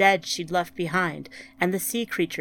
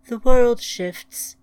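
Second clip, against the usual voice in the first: slow, loud, raised pronouncement; planet voice; 5 semitones higher, 9 dB louder, slower than usual. A narrator's reading. The planet answers grieving Ryan, slow pronouncement. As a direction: pronounce it slowly and loudly as a planet.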